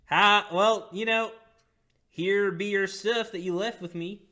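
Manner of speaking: high, loud innkeeper voice; Stink Eye's voice. Acted. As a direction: hand back the stuff as the odd innkeeper. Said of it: "I think my voice came back to me".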